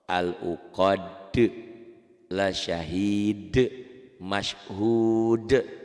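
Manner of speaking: Al-uqad, La syahid, Mashhud each halted on a bounced d; qalqalah stops. Shown as a demonstration. Teacher reads out qalqalah examples, bouncing each ending. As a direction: stop on each final d of "Al-uqad", "La syahid", "Mashhud" with a short echo. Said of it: "semua ini contoh qalqalah".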